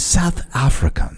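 put-on Britishy-sounding South African accent. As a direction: attempt South African, landing near British. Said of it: "kind of Britishy sounding".

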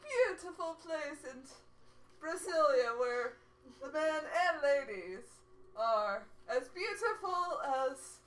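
put-on measured charmer with a Spanish accent; Matthias. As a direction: introduce yourself grandly in a put-on Spanish accent. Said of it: "kind of a Spanish accent".

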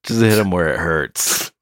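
normal talking voice

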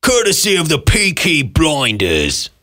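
hammed-up British gangster-show accent; thick English accent. Co-host imitates a Peaky Blinders actor, thick English accent. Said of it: "his English accent is so thick".

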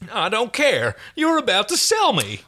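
high-pitched, outraged protest; indignant. Acted. John accuses Aaron of selling him, indignant.

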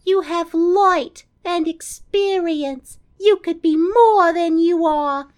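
high, slow sultry voice; breathy seductive Audrey. Retold or imitated. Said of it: "gets all sultry".

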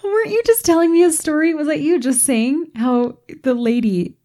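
high and quick through laughter; laughing question. Real, not acted. Host laughing as she asks her co-host about a story. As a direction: ask it while still laughing.

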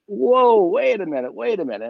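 retold panic as the arrest begins; startled protest. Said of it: "it's like".

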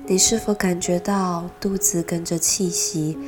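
normal talking voice

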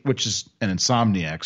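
ordinary delivery

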